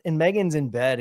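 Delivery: ordinary talk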